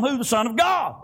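ordinary talk

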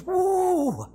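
high, held whoop; preacher's acted-out "woo"; excited woo. Acts out John's excited holler.

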